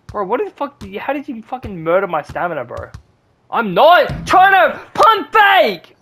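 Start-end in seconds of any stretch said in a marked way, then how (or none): 3.5-6.0 slow, very high, loud yell; raging shout of disbelief; furious shout. Real, not acted. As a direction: bellow it at the game, stretching every word. Raging at the controller.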